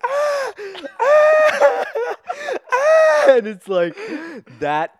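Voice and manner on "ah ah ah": high, loud yelps one after another; repeated pained yelps. Retold or imitated. Ace hit by more spears, repeated high yelps. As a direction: yelp again and again in pain. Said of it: "he's just like".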